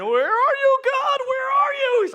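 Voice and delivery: very high, loud whiny cry; wailing baby believer. Acted. A baby in Christ demanding that God answer, loud wailing. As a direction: wail it out like a needy baby. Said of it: "when you're a baby in Christ".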